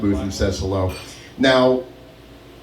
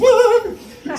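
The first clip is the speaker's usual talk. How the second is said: high, squealed mock shock; hammed-up startled squeal; shocked what. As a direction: squeak it out in alarm. Coworker's shocked reply.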